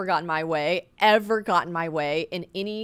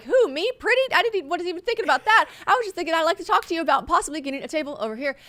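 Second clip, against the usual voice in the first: put-on high, fast, sugary innocent girl voice; a girl pretending not to know she's pretty; coy nice girl; 8 semitones higher, faster than usual. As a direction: play dumb and sweet to get the table. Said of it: "the nice girl that's like".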